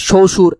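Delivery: "শ্বশুর" said as "shoshur", no b sound; silent ব. Shown as a demonstration. Teacher showing the ব has vanished, "শ্বশুর" as "shoshur". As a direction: drop the ব fully in "শ্বশুর": sho-shur. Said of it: "বই তো গায়েব হয়ে গেছে".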